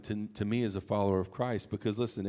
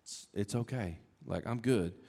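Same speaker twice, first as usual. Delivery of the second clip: much softer, calm reassurance; reassuring; 6 dB quieter than usual. Retold or imitated. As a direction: soothe a worried friend quietly. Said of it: "they're writing you like".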